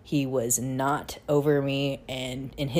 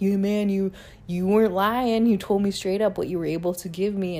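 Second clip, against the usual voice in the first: higher, louder bro voice conceding the point; mock dude voice; 5 semitones higher, 4 dB louder than usual. Ex-boyfriend admitting she never lied, in a mock bro voice. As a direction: lightly play a dude admitting she was right.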